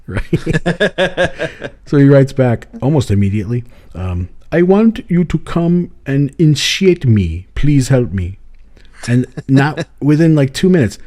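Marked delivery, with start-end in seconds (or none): 4.5-8.4 imitating an African accent. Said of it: "But if I do this, then it's him".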